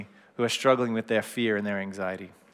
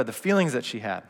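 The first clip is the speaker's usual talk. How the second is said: ordinary delivery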